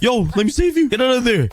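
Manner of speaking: high, urgent rescue cry; high-pitched alarm; urgent call. Real, not acted. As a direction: hurry the villager out. Rescuing a freezing villager.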